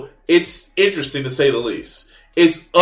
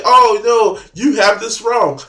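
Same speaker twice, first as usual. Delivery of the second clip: higher-pitched mock objection; mocking commenter; 5 semitones higher, 4 dB louder, slower than usual. Creator imitating fools in the comment section, mocking. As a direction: lightly put on a protesting commenter. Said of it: "and say".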